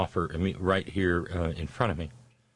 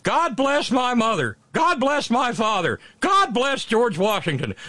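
much higher, louder, slow sing-song blessing; movie character quote; 13 semitones higher, 4 dB louder, slower than usual. Retold or imitated. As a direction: intone each blessing slowly, raised high like a pious boy. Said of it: "he's standing there saying".

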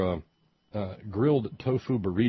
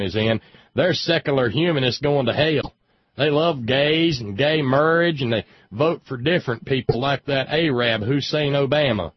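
louder hammed-up preachy good ol' boy; mock southern ranter; 5 semitones higher, 7 dB louder than usual. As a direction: bellow it like an indignant talk-radio caller. Mock caller ranting about Yankees.

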